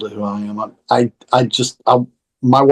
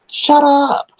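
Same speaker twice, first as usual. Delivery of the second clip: very high, playful exasperation; mock indignant retort; 13 semitones higher, 4 dB louder than usual. Answering people asking where the accent went, mock indignant. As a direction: toss it back high in mock outrage.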